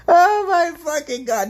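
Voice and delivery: high, slow, exasperated "oh my God"; amused exclamation. Real, not acted. Still laughing at his own joke, high slow exclamation.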